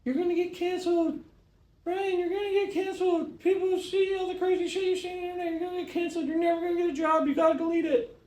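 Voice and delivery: high squeaky mock voice of a worried kid brother; imitating little brother. Mocking his little brother's warning in a high squeaky voice. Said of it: "this is how he talks".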